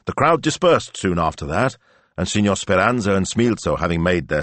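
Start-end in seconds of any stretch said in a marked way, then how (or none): none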